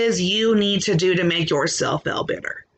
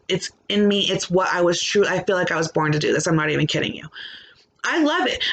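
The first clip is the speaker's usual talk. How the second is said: ordinary delivery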